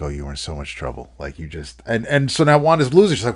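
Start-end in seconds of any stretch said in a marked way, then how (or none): 0.0-1.8 low, quiet, quick mock scolding; a lightly voiced smug dog, half under the breath; retelling a comic, a dog taunting its sitter in a low quick voice. Dog Frida scolds Wanda.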